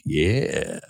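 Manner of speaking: low, drawn-out mock yeah; a low, hammed-up ogling reply; suggestive approval. Imagined look at sexy walker.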